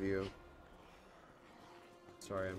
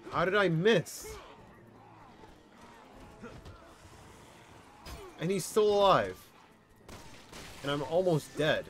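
frustrated outburst; 7 semitones higher, 4 dB louder than usual. Real, not acted. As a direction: yell at the missed shot. Frustrated at missed shot.

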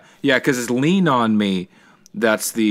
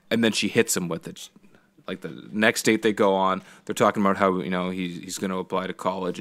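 ordinary talk, nothing marked

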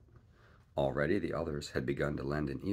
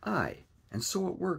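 much higher, loud relieved cry; cried out; 14 semitones higher, 4 dB louder than usual. A narrator's reading. Morgan agrees with Silver's guess, a high excited cry. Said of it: "cried Morgan".

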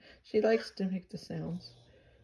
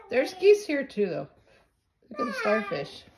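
excited; 6 semitones higher, 6 dB louder than usual. Real, not acted. Excited, high and loud.

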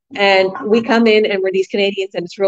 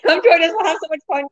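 laughing invitation; 8 semitones higher than usual. Real, not acted. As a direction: Come join us, higher and laughing through it. Laughing invitation after big laugh.

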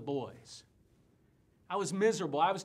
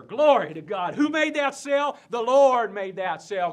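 loud revival-tent testimony; booming, triumphant preacher; exultant praise shout; 4 semitones higher, 13 dB louder than usual. Acted. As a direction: shout the glory out to the congregation. Preacher exults over his sale.

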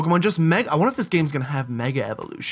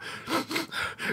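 put-on old lady gasp; 12 semitones higher, 11 dB quieter than usual. Emotional auntie.

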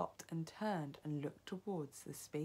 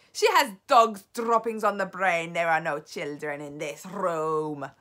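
loud, imperious dismissal; scornful shout; 12 dB louder than usual. A narrator's reading. Grand High Witch dismissing the witch's claim, shouting. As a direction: shout the claim down, falling at the end. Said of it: "shouted the Grand High Witch".

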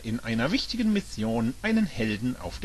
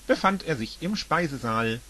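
usual speaking voice

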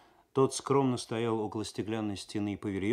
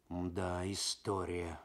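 thoughtful; 4 semitones lower, 7 dB quieter, much slower than usual. A narrator's reading. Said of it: "задумался тот".

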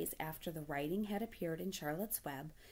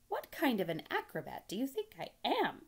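raised-pitch exasperated question; indignant rebuke; 8 semitones higher than usual. Acted. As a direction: scold with an incredulous question. Charlotte rebukes the gander.

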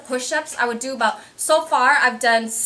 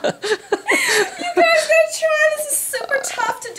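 strained laugh; slower than usual. Real, not acted. Strained laughing during painful exercise.

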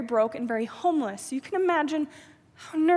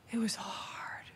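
whispered; 3 semitones lower, 13 dB quieter, slower than usual. Real, not acted. Repeats the line, hushed.

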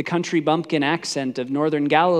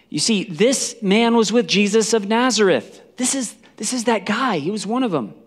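raised, higher-pitched pointing-out; accusing; 7 semitones higher, 5 dB louder than usual. Acted. Servant girl telling bystanders about Peter, accusing and raised. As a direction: call him out to the crowd.

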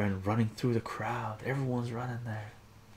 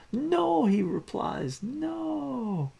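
panicked denial; 4 dB louder, much slower than usual. Acted. Ataru protests loudly.

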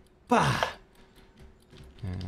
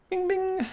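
high-pitched "bing, bing" bell; ding like a service bell, held high; imitated bell. Mimics the order bell.

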